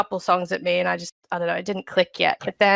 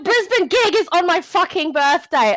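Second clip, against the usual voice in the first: excited; 8 semitones higher than usual. Real, not acted. Tour date on her birthday.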